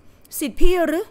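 shocked recognition, voice lifting; startled disbelief. A narrator's reading. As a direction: blurt it as a stunned, slow question. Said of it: "ทำเอาเซินจือหลีตื่นตระหนก".

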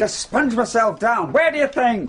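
irritated. Acted. Angry husband.

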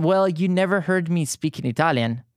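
normal talking voice

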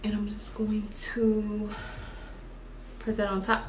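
whisper. Real, not acted. Quiet aside while applying.

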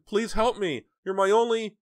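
high, loud frantic plea; terrified begging. Acted. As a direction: cry out for help.

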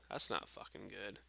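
ordinary delivery

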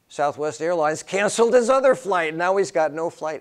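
loud, high rush of alarm; excited burst. Real, not acted. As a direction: belt out the bad news. Retelling son's flight trouble.

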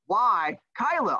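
normal talking voice

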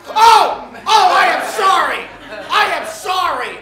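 loud, high, overdone gasping apology for a slip; mock horrified apology. Swearing on morning TV, apologizes in a loud mock panic. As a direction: ham up the shocked oh and the sorry.

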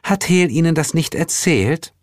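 high, bright-eyed sweet question; delighted hostess; beaming goddess. A narrator's reading. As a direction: ask in happy surprise, high-voiced. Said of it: "Ostara strahlte".

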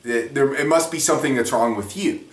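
ordinary delivery

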